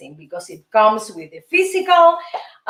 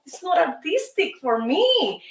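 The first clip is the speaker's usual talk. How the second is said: usual speaking voice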